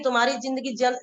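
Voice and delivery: ordinary talk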